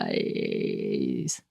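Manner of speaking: a rattling, unvoiced throat clicking; clicker click noise. Shown as a demonstration. Host doing a bad clicker noise for the co-host. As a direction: mimic a clicker's clicking call. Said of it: "That's my clicker bad noise".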